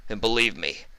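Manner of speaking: lightly voiced menacing boss; threatening Don. A narrator's reading. The Don pinning Vinyl to the wall, a hint of menace.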